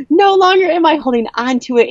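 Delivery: very high, fast triumphant cry; joyful scream. Retold or imitated. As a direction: rush it out in elation. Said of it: "I just kept screaming".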